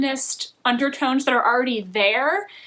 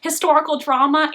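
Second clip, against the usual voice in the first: ordinary talk, nothing marked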